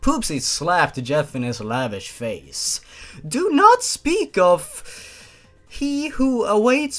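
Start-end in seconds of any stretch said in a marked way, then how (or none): 3.3-7.0 much higher, louder, slow dramatic command; forbidding shout. Acted. Poopsie after slapping Jeff, forbidding the name in a slow loud shout. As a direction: thunder it slowly, hammed-up.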